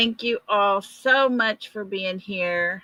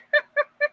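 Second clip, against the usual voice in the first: sudden high giggle; short squealing laugh; high burst of laughter. Real, not acted. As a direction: laugh at your own joke. Laughs at own joke.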